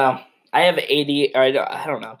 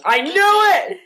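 very high, louder squeal of triumph; squeaky triumph; 4 dB louder, slower than usual. Acted. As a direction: squeal it like you called it.

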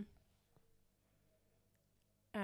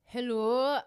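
higher, louder Scottish hello; Scottish accent; 4 semitones higher, 6 dB louder than usual. Shown as a demonstration. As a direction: pitch up a Scottish greeting.

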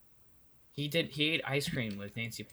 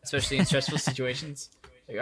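normal talking voice

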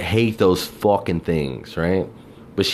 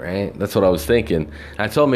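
ordinary talk

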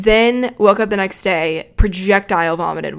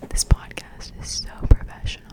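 whispered, embarrassed; real whisper; 4 dB quieter than usual. Real, not acted. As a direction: hush it out, shy of being heard. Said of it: "I get, like, stage fright".

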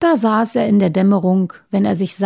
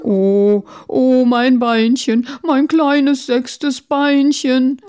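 put-on old beetle's drawn-out slow wail, higher; lamenting cry; 3 semitones higher, 3 dB louder, much slower than usual. Poor great-grandfather wails for his little sixth leg, slow lament. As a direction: mourn the lost leg, very slow. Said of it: "schrie der arme Urgroßvater".

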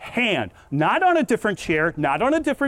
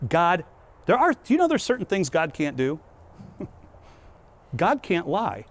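normal talking voice

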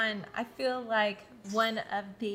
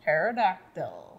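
slow, low, teeth hidden; lips over teeth; 6 semitones lower, slower than usual. Shown as a demonstration. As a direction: drag it out with covered teeth. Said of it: "without showing your teeth".

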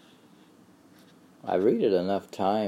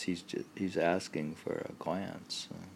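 usual speaking voice

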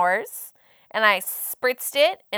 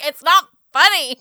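raised, indignant mock scolding; imitating mad husband; 5 semitones higher, 6 dB louder than usual. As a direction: mimic him snapping, amused underneath. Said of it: "he wasn't so mad about it".

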